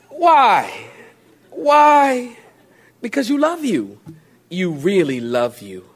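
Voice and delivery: usual speaking voice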